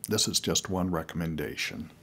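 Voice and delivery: ordinary talk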